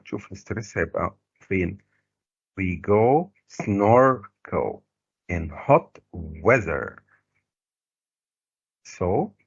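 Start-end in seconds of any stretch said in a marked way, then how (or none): 2.6-7.0 SNORKEL stretched slowly with a strong first syllable; slow model reading, leaning on SNORKEL and WEATHER; marked word stress. Shown as a demonstration. Teacher showing students where stress falls, marked word stress. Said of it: "هنعمل stress كده".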